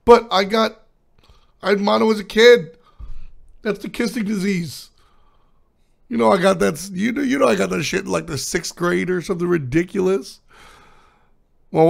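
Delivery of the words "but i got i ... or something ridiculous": incredulous protest. Retold or imitated. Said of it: "And I was like".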